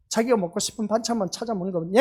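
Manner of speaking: ordinary talk, nothing marked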